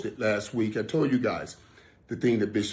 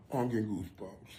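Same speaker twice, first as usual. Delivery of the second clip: ordinary delivery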